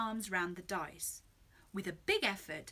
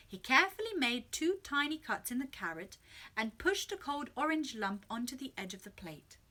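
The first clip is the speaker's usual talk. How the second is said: usual speaking voice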